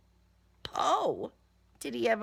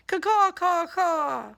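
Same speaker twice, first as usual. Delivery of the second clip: loud, high, harsh cawing; angry crow caw; 7 semitones higher, 6 dB louder than usual. Acted. Knocked-down crow scolding the narrator, loud cawing. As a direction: squawk it like an offended crow. Stunned crow cries angrily.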